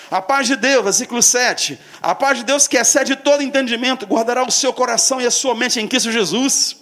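loud, high preaching voice. Acted. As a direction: proclaim it to the church. Preaching at full force.

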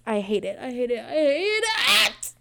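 half-sobbing laugh at her own frustration; slow, whiny laughing rant; laugh-crying frustration. Real, not acted. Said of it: "I need to calm down".

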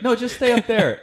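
high, chirpy imitation of the host; lightly mocking high voice; mock host lady. Imitating host lady at open mic.

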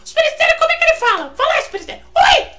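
high, loud squealing cartoon voice; Super Histérico impression. Shown as a demonstration. Drunk [name] doing Super Histérico for the audience, high shrieking voice. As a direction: shriek it like a hyper cartoon character. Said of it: "eu consigo imitar mais ou menos assim".

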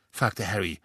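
plain reading voice

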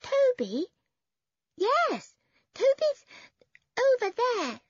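put-on very high, loud child's voice; small boy. A narrator's reading. Small boy directs visitors to his brother, small child's voice. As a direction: pipe up as a little boy. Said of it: "said the boy".

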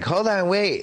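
high, sudden hold on; hammed-up urgent stop; mock character voice. Voicing the character in a scene.

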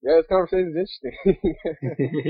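choppy, breaking-up call audio; glitchy connection dropping out; corrupted glitchy audio. Real, not acted. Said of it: "the audio's going a little wonky here".

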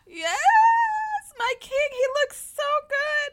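fangirl squeal. Real, not acted. Fan swooning over B I in the video, squealing. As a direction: gush at the idol like a swooning fan.

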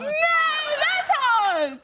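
squeaky drawn-out voice. Pretend. High, slow put-on answer.

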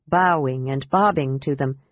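ordinary delivery, nothing marked

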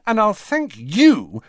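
high, indignant telling-off; angry. A narrator's reading. Ginny tells her brothers to keep out, angry. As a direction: snap back angrily, leaning on the last words. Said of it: "she added angrily to Ron".

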